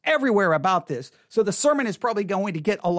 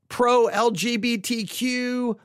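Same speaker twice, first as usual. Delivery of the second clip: usual speaking voice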